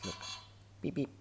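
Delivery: usual speaking voice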